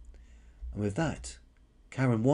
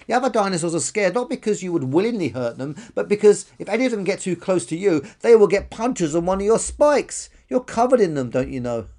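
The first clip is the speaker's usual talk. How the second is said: high, loud character voice; frank brontosaurus, explaining; 9 semitones higher, 8 dB louder than usual. Acted. Brontosaurus tells spiky dinosaur about punctures, frankly explaining. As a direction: explain insistently in a raised voice.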